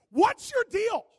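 loud high shouted challenge; angry shout. Retold or imitated. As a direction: yell it at the offender. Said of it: "you're like".

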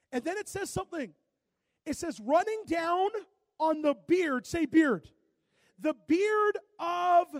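loud, rising sermon build; preaching at full force. Acted. Pastor preaching to his church, loud and rising. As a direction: drive it home to the congregation.

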